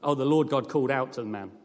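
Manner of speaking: ordinary delivery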